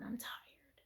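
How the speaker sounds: weary whisper to the Lord; whispered prayer. Retold or imitated. Praying to the Lord before bed, weary whisper. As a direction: breathe it out, spent. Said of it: "I said, "Lord".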